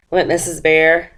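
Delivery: ordinary delivery, nothing marked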